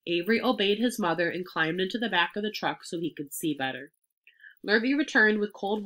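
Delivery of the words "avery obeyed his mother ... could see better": ordinary delivery, nothing marked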